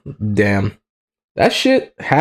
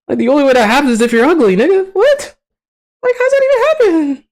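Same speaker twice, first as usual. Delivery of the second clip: high, louder falsetto outburst; incredulous squeal; 4 dB louder than usual. Real, not acted. Ranting to viewers about an absurd plot, incredulous squeal. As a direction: blurt it out in disbelieving falsetto.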